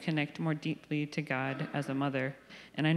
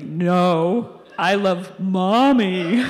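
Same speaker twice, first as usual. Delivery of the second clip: put-on toddler voice, louder and very slow; stubborn toddler repeating himself, louder and drawn out; toddler insisting; 10 dB louder, much slower than usual. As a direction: draw it out like a stubborn three-year-old. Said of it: "And he's like".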